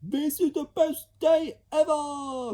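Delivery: high loud shout of joy; child shrieking with delight; ecstatic shout. Acted. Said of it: "cried another".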